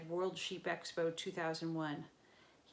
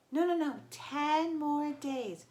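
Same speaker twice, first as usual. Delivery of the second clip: higher, louder pleading falsetto, slow at the end; Grover in falsetto; 9 semitones higher, 7 dB louder, slower than usual. A narrator's reading. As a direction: bargain in a panic.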